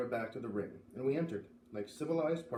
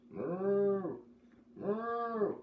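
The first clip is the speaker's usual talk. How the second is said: mooing cow; 7 semitones higher than usual. Acted. Narrator mooing as the bolting heifer. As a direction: moo like a runaway cow.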